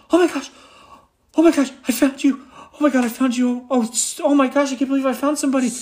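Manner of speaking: put-on a young gnome bursting with panic and relief; frantic gnome. Young gnome finding the party at dawn, frantic high cries. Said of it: "the gnome comes up and says".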